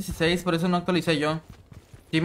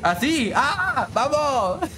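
excited cheer; 8 semitones higher, much slower than usual. Real, not acted.

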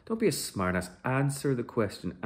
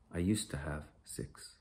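whisper; 5 semitones lower, 7 dB quieter than usual. Acted. Father telling soldier of lost child.

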